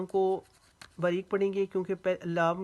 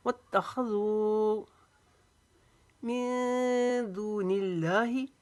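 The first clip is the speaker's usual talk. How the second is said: slow, higher Quran recitation, long held vowels; drawn-out recitation; 4 semitones higher, 4 dB louder, much slower than usual. Shown as a demonstration. Teacher reciting the verse whole, drawn-out higher tajweed chant. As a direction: stretch each word in measured tajweed. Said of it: "खींच कर पढ़ेंगे".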